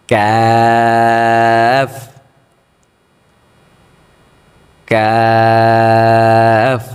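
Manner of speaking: কাফ drawn out slowly, formed in the mouth and not the throat; kaf from the mouth. Shown as a demonstration. Teacher showing students a mouth-formed কাফ. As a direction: say কাফ long, from the front of the mouth. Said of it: "এটা মুখ থেকে হবে, গলা থেকে না".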